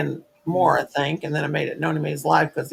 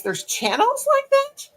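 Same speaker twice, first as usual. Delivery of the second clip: high, slow, astonished; amazed high question; slower than usual. Retold or imitated. Stranger learning crochet channels exist, amazed and high. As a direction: gasp it out in disbelief.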